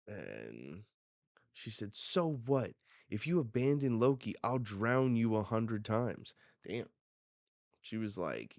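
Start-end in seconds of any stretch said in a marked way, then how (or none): 2.0-6.3 loud, raised-voice threat; heated retort, louder and higher at the start; acted angry threat. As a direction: threaten them fiercely, playing it up.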